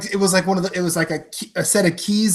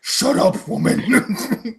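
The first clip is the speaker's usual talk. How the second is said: gruff Kratos; 8 semitones higher, much slower than usual. Retold or imitated. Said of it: "Kratos scene".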